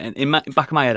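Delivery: usual speaking voice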